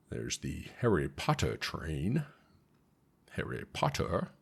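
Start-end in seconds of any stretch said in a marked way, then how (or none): 0.8-2.2 soft, slow attempt at an English accent. Vlogger showing off the Harry Potter train, mock English accent. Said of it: "That's my best English accent". 3.3-4.3 softer, lower mock English accent. Vlogger repeating a film title for fun, English accent. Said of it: "That's my best English accent".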